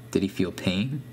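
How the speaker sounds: ordinary delivery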